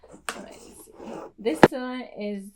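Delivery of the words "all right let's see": soft whispered aside to herself. Real, not acted. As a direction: whisper it like a secret peek. Whispers while opening box.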